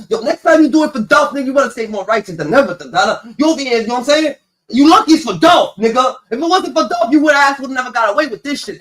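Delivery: high, loud warning; mock angry scolding. Father lecturing his thieving son, loud mock scolding. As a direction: warn him off in a loud mock rant.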